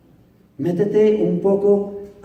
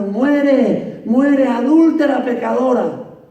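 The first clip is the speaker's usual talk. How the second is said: higher, louder mob cry; crowd yelling; 7 semitones higher, 5 dB louder, slower than usual. Retold or imitated. Preacher voicing the mob demanding the woman's death, shouting. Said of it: "multitud de trescientas personas gritando".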